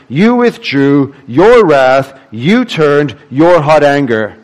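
slow, each "you" and "your" leaned on in turn; stress on every you. Shown as a demonstration. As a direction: read it slowly, pressing "you", "your" each time. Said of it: "Where's the emphasis?".

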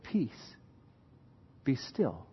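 soft, low, calm command; hushed command. Acted. Jesus stilling the storm, soft and calm. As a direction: speak peace quietly over the storm. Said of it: "And he said".

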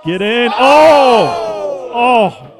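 loud excited shouts at a near miss; excited shouting. Real, not acted. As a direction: yell at the chance. Near goal, shouting at screen.

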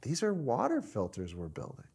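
soft, sober explanation; calm revelation. Acted. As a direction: reveal it quietly to a confused coworker. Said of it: "Jim says".

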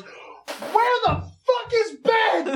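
very high, drawn-out mock yell; stretched-out high heckler's cry, not truly loud; imitated loud heckle. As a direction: holler it like someone at the back of the auditorium. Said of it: "really fucking loudly goes".

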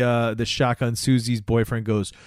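normal talking voice

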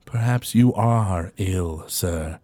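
slow, formal servant voice; a courteous butler speaking with measured calm; attendant NPC. Acted. Attendant calmly reassuring his confused master.

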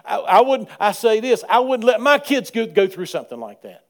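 loud, high pulpit delivery; full-force preaching. Acted. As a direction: drive the point home to the congregation.

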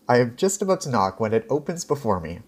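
usual speaking voice

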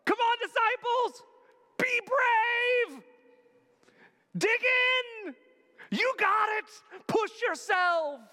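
high, loud pep-talk shouting; coach yelling. Pretend. Jesus yelling encouragement from the shore like a coach. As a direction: yell it like a coach on the sideline. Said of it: "stand on the shore and yell to him".

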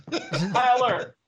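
slow, high, laughing. Real, not acted. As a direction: drawl it out with a laugh. Joke, laughing.

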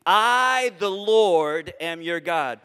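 I and Lord spaced with pauses and punched loud; proclaimed slowly. Shown as a demonstration. Preacher proclaiming God's name to the congregation, loud and deliberate. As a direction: declare I, the Lord, each phrase landing heavy. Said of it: "Would you say that phrase with me?".

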